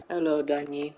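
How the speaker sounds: usual speaking voice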